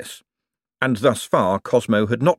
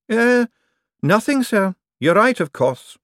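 high, hasty agreement; timid servant, much higher, a little louder; nervous backing down; 12 semitones higher, 4 dB louder than usual. Acted. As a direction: cave in quickly to the boss. Secretary backing down.

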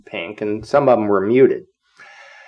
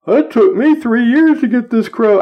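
high-pitched mock plea; put-on high voice of protest; dismayed protest; 12 semitones higher, 3 dB louder than usual. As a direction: imitate a sailor's upset outburst, pitched way up. Demoted sailor protesting, acted out.

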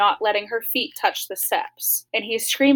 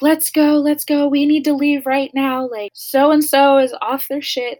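louder and higher, rushing everyone out; urgent retold yell; 4 semitones higher, 4 dB louder than usual. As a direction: sound alarmed, hurry them out the door. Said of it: "and is like".

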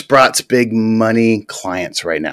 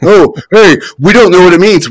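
higher, louder mocking whine; 6 semitones higher, 6 dB louder than usual. Mimicking his co-host, higher, louder mocking tone.

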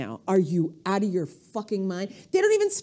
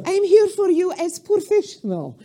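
put-on loud, comic Russian accent; 6 semitones higher, 4 dB louder than usual. Comedian jokingly declaring professional credentials, in a hammed-up Russian accent. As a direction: put on a big Russian accent, higher and louder. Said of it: "that's a Russian accent".

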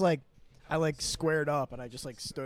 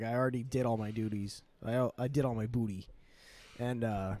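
ordinary delivery

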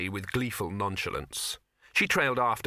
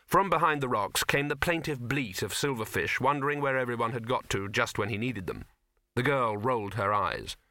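ordinary delivery

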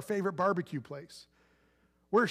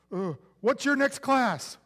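put-on awkward high school boy; 5 semitones higher, 4 dB louder, slower than usual. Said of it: "And he's like".

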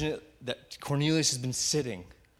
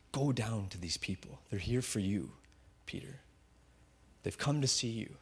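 softer, hushed voice of the Spirit, not a true whisper; 4 semitones lower, 7 dB quieter than usual. Acted. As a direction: gently hush it like a voice within. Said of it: "the Spirit whispers to him".

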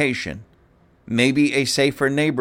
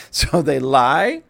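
usual speaking voice

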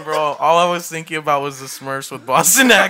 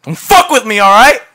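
much louder and higher, shouting him off; acted angry shout; 7 semitones higher, 11 dB louder than usual. Young man shouting at his therapist to back off, furious. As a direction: yell it to push him away.

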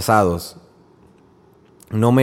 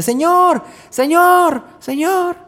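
desperate cries; much slower than usual. Acted. Mother crying out to Jesus for her daughter, desperate high shout. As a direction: call out to Jesus over a crowd. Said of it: "Y está grite y grite y grite".

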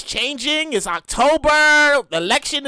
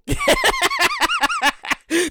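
high laugh. Real, not acted. Podcaster laughing at his own hype yell, high laugh.

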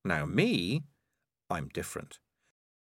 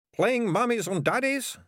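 much higher, cheerful thug voice; cheerful sidekick; 13 semitones higher, 3 dB louder than usual. A narrator's reading. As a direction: chirp it cheerfully, high up. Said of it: "said Ratcatcher Two cheerfully".

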